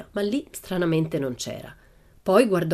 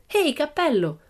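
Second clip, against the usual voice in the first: mild shriek; 10 semitones higher than usual. A narrator's reading. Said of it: "strillò poi".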